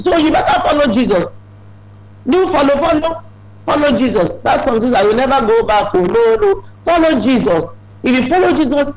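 preacher's fervent appeal; fervent exhortation. Acted. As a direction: drive the call home to the congregation. Exhortation at full force.

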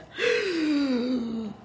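sharp gasping inhale; deep gasp. Acted. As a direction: suck in a big breath. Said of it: "Loon took a deep breath".

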